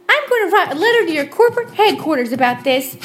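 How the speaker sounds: indignant customer threatening the clerk, shrill; shrill threatening woman. Retold or imitated. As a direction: storm off promising a complaint letter. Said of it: "with a shrill voice".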